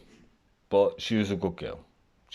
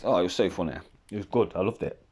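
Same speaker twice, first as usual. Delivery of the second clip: ordinary talk, nothing marked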